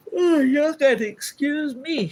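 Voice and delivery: high, slow words stretched by a yawn. Real, not acted. Vlogger apologizing to her viewers while yawning. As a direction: push the words out through a big yawn. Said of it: "I don't know why I'm yawning".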